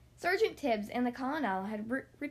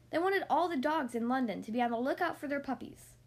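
usual speaking voice

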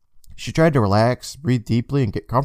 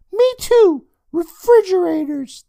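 put-on sarcastic kid voice; 6 dB louder, slower than usual. Boy mocking sister.

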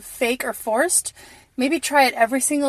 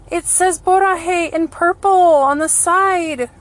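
moved and excited; 7 semitones higher, 6 dB louder, slower than usual. Real, not acted. Moved by the purple print.

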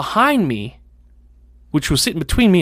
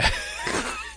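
stifled burst of laughter; 11 dB quieter than usual. Real, not acted. Cracking up in class.

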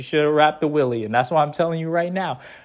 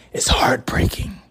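slow mock-tragic whisper on "heartbreaking"; 4 semitones lower, slower than usual. Host announces the topic title to listeners in a slow mock-tragic whisper. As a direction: breathe out "is heartbreaking" like a sad confession.

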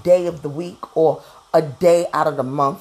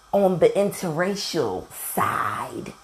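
whisper; slower than usual. Real, not acted. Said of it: "whispered aside on a touchy topic".

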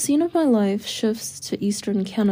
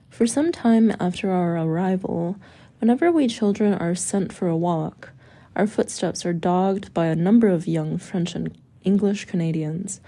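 ordinary delivery

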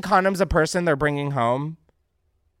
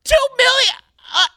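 very high, loud squeal; high squeal of shock; shocked shriek; 10 dB louder, faster than usual. Real, not acted. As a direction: shriek the number in disbelief. Host echoes in shock.

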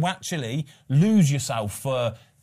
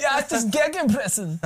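German accent; 11 semitones higher, 3 dB louder than usual. Shown as a demonstration. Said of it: "Say it in a German accent".

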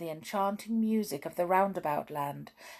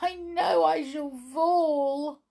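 high, slow acted wail; tearful little girl, hammed-up; weeping; 10 semitones higher than usual. A narrator's reading. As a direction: draw the words out in a tearful, high-pitched storybook cry. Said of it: "she wept".

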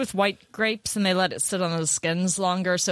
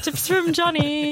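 slow, high jingle-style title; segment announcer; 9 semitones higher, slower than usual. Pretend. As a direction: announce it like a radio bit.

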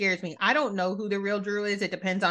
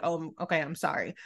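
lower, softer mock apology; 5 semitones lower, 6 dB quieter than usual. Answering a fair complaint, softly lower apology. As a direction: back down quietly. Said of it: "I'll be like".

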